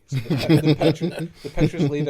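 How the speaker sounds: laughing through the words; giggling while telling it. Real, not acted. Laughing while describing sketchy pitch.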